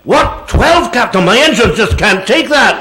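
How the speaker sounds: high-pitched, alarmed Scottish engineer; Scotty. Acted. Engineer answering the captain's warp order, high Scottish protest. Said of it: "instead of hearing the familiar".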